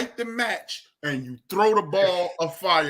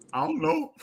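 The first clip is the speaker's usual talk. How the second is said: put-on higher, meek little-boy voice; imitated sheepish kid, pitched up; little boy's answer; 4 semitones higher than usual. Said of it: "And that boy say".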